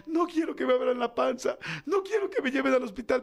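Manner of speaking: niño quejoso en tono agudo, fingido; llanto infantil imitado. Retold or imitated. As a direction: imita a un niño que protesta llorando. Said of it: "está llorando y gritando". (lloriqueo de niño imitado)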